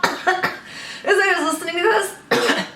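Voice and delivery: coughing, then speaking through a sore throat; strained after coughing. Real, not acted. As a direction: push the words out through a raw throat. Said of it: "if you hear my voice sound funny".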